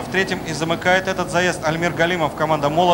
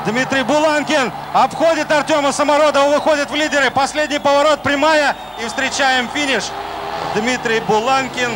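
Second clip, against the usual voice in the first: excited commentary; 8 semitones higher than usual. Real, not acted. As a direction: shout the finish as he overtakes. Thrilling finish overtake call.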